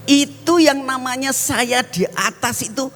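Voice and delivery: ordinary talk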